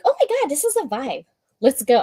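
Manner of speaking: high-pitched gush, imitating sudden excitement; acted delight. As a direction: act out being thrilled by a song. Reenacts delight at daughter's music.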